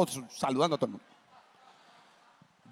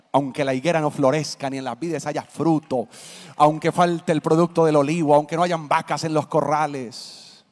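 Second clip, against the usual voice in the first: preaching scripture; 5 dB louder than usual. Acted. Quoting scripture from the pulpit.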